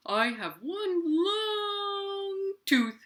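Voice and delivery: high, very slow, crying-out puppet voice; stretched narwhal wail. Pretend. Narwhal puppet proudly telling the kids about its one long tooth, slow and high. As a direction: draw the words out high like a wailing sea creature.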